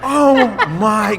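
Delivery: real laughing disbelief. Real, not acted. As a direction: laugh out the shock. Reacts to Dave Portnoy guess.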